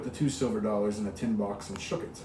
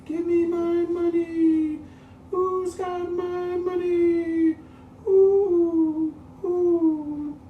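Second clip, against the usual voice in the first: very high, very slow, louder moaning wail; ghostly wail; 8 dB louder, much slower than usual. Acted. Dead woman's ghost wants her money, high slow wail. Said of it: "a voice cried".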